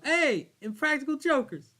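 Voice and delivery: loud, high, slow call; fan calling out. Retold or imitated. Stranger calling to host at the gas station, loud call. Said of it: "somebody pointed me and go".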